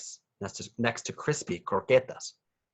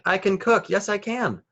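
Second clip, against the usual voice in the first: much higher, eager voice; excited child; 11 semitones higher, 4 dB louder than usual. A narrator's reading. Nacho tells Mommy he can cook, high and eager. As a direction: bounce with excitement. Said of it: "Nacho cried".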